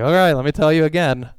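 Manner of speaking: high, loud, fast weary nag; affectionate nagging. Retold or imitated. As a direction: sigh it out and tell them again. Parent repeating instructions to kids.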